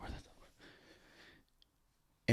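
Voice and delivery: breathless whispered curse; hushed, scared whisper. Retold or imitated. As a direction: whisper it into the dark room. Waking at night, looking for dolls.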